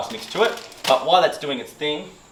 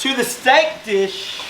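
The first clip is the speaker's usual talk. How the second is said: slow, high, drawn-out announcement; mock announcement; 9 semitones higher, much slower than usual. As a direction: herald the next dish with playful grandeur. Playful announcement of next dish.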